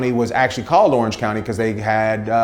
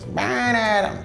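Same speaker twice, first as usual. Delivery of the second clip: put-on drunk heckler; 12 semitones higher, slower than usual. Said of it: "And she goes".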